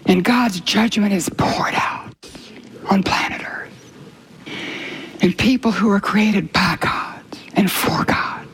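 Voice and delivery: slow, breathy whispered dread; hushed whisper. Real, not acted. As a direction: whisper it slowly, heavy with judgment. Solemn sermon on God's judgment.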